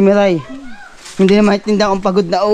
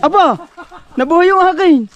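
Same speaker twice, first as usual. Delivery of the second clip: excited shout; 10 semitones higher, 4 dB louder than usual. Real, not acted. Surprised it came back on.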